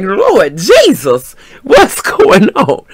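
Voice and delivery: loud, drawn-out mock cry for help; laughing alarm. Woman lifted off her feet by a dancer, laughing alarm.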